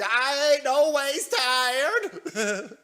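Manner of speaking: exaggerated mock-Southern drawl, stretching each word; Hillary Clinton. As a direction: fake Southern accent, high and very drawn out. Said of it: "doing some sort of fake".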